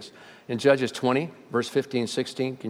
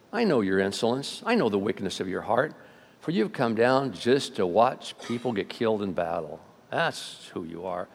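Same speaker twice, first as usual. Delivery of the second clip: cold anger; 6 semitones lower, 6 dB quieter than usual. Retold or imitated. Brother accusing David of wickedness, cold quiet anger. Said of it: "Eliab's anger burned against David".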